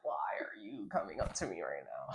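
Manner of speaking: hushed, breathy aside; whisper. Retold or imitated. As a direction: whisper it so they won't hear. Annoyed friend retold, whispered.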